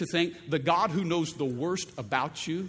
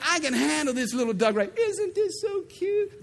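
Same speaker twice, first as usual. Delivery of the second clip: cooing at duck; 10 semitones higher, 3 dB louder than usual. Retold or imitated. Person cooing over a cute duck, high baby-talk.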